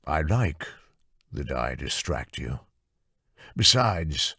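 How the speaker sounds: a hint of a smug patron. Lord Dressen voiced.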